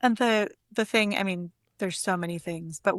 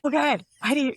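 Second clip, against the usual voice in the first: mock exasperation; 7 semitones higher, faster than usual. Admiring a friend's poems, a mock exasperated cry. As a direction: throw up your hands at how good she is. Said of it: "And it's like".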